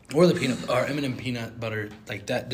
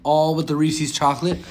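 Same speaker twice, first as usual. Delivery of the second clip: usual speaking voice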